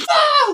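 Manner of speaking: high squeaky laugh; burst of laughter. Real, not acted. Host laughing at her own plug, high squeak. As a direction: crack up at yourself.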